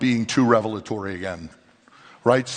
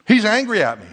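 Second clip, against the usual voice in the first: higher, louder mock accusation; hammed-up complaint about him; a touch of indignant whine; mock angry claim; 9 semitones higher, 5 dB louder than usual. Imagined angry brother.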